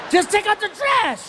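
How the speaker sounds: high frustrated snap; dad fed up with stalling son; exasperated yell. Retold or imitated. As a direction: lose patience with him.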